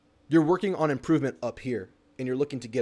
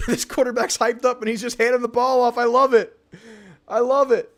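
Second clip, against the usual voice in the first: high, loud, elated cheering; real thrilled outburst; 9 semitones higher, 7 dB louder than usual. Real, not acted. Fan reacting to the quarterback's handoff, loud and thrilled. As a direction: build to a delighted shout.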